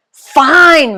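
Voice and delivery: a loud, high, exasperated "Fine"; giving in. A narrator's reading. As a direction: Mom caving loudly. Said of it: "Mom says".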